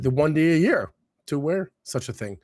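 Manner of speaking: ordinary talk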